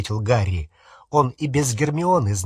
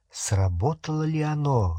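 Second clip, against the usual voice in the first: ordinary delivery, nothing marked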